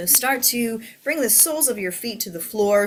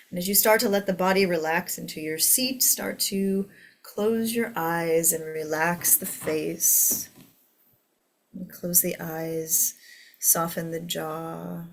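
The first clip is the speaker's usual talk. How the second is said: guided meditation. Acted. Leads a meditation.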